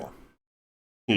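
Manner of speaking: ordinary delivery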